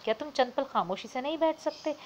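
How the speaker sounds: sharp irritated demand, high pitch; snapping sharply. A narrator's reading. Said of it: "तेज़ी से बोली".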